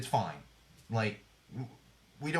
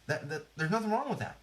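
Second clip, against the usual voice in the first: ordinary delivery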